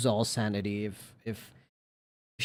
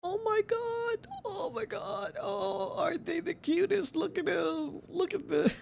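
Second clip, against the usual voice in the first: very high, squeaky cooing; cute voice; 3 dB quieter than usual. Real, not acted. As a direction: gush over the cutest cat. Cooing over cat pictures.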